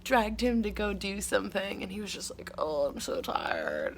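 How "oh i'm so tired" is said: much lower, slow, worn-out dad voice; tired dad. Retold or imitated. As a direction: sag into an exhausted father's groan. Dad groaning after long day.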